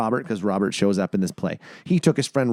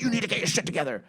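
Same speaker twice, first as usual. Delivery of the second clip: pep talk; 8 semitones higher, 4 dB quieter, faster than usual. Retold or imitated. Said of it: "a pep talk".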